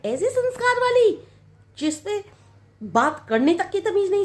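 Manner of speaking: high, mocking scorn for her manners; scornful sneer. Mother-in-law scorning the bride's manners, high and mocking.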